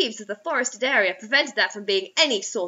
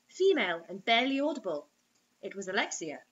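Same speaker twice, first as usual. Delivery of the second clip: ordinary talk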